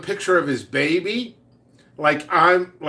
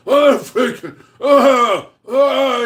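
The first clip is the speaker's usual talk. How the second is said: higher, louder sputtering oh's; flustered sputter; 9 semitones higher, 7 dB louder, much slower than usual. Retold or imitated. Offended coworker lost for words, flustered loud sputter. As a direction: sputter like you can't find words. John speechless and upset.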